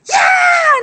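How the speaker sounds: very high, loud whoop of joy; teacher pouncing on wrapped kids, whooping; gleeful shout. Pretend. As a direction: let out a wild cheer as you pounce. Tearing into the kid presents.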